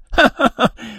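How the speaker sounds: high, sneering bird laugh; the canary cackles at his trapped friend; mocking cackle. A narrator's reading. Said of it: "Le canari ricana". (ricanement moqueur aigu)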